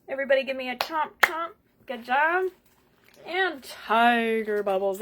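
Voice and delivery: plain reading voice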